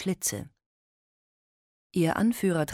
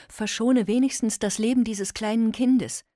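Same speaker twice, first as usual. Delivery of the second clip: high, loud desperate plea; pleading; 7 semitones higher, 5 dB louder than usual. Acted. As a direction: beg for the child's life. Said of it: "flehte Modesta Bruder Timotheus an".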